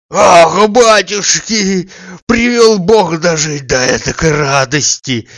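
bow and gush with blessings; overjoyed old servant. A narrator's reading. Old servant Zakhar congratulating the master's friend, loud rejoicing. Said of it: "кланялся, улыбался, сипел, хрипел".

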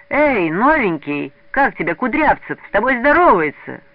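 loud jeering schoolkids; kids shouting. A narrator's reading. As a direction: call out to the newcomer. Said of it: "закричали вокруг ребята".

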